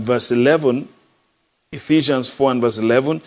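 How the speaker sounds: ordinary delivery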